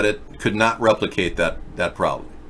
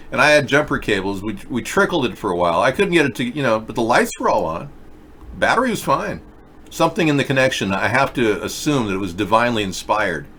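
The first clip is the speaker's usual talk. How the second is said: ordinary talk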